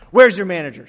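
loud mock customer outburst; indignant demand. Customer refused a milk refund, indignant demand. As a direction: demand it loudly, like a fed-up customer.

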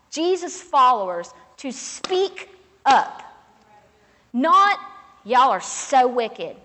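loud, high preaching cry; full-force preaching. Acted. Pastor's wife urging the congregation to speak up, preaching at full force. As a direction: drive it home to the whole church.

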